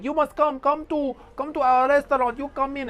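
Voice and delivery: put-on high, pushy Turkish-accented patter; imitated Turkish tout. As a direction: hustle them inside in a mock Turkish accent. Imitates Turkish restaurant touts.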